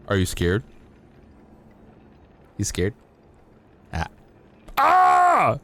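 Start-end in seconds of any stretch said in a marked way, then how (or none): 4.8-5.6 a sudden very high, loud shriek; jump-scare yell. Pretend. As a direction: burst out with a shrill scream to startle.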